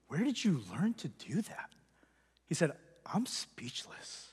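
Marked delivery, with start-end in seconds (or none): 0.1-1.7 stunned, hushed repeat; awed whisper. Retold or imitated. 3.1-4.2 slow, hushed whisper; whispered in disbelief; stunned whisper. Retold or imitated. Dad stunned after the sermon.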